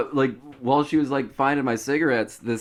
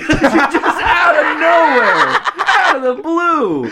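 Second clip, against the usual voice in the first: high, loud, slow, helpless laughter; cracking up; 6 dB louder, slower than usual. Real, not acted. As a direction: struggle to get it out through laughter. Telling it while cracking up.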